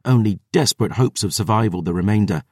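usual speaking voice

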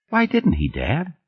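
put-on high-pitched child's questioning; small girl pressing Dad for an answer; little daughter. A narrator's reading. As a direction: ask like a puzzled child. Small daughter questions her father.

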